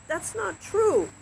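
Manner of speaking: high, slow, insistent protest; yelled. Acted. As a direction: keep the yell going, stretched and high. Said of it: "yelled Job".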